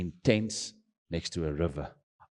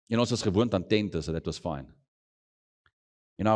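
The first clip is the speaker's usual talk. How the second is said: ordinary talk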